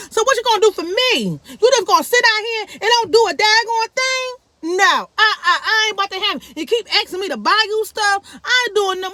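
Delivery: mother demanding what her son will do for her; scolding mother. Retold or imitated. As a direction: put him on the spot, exasperated.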